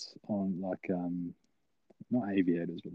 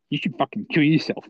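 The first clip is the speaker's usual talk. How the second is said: higher-pitched mock attack; imitating angry girl; 6 semitones higher, 4 dB louder than usual. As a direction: lash out as the offended friend. Angry girl's attack retold.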